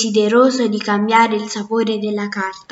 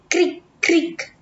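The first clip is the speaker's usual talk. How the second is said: higher, crisp chewing noise; crunching sound effect; 6 semitones higher than usual. A narrator's reading. Narrator voicing mice chewing flour, crunchy. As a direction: chomp it out like a mouse. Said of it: "come tutti i topi quando masticavano".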